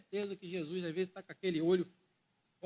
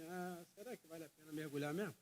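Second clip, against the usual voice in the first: doubtful mutter; 5 semitones lower, 13 dB quieter than usual. Pretend. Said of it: "ele já tava assim".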